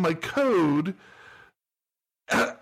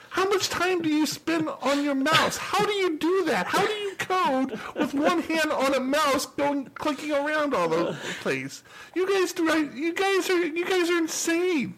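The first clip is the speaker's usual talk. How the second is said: high, pitched-up disbelief at mouse users; incredulous rant; 8 semitones higher than usual. Real, not acted. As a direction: rant at them in amazed outrage.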